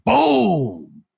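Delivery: long drawn-out boom; big swooping boom; impact boom. Retold or imitated. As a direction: stretch out the crash of a landing. Narrating a wrestling move's impact.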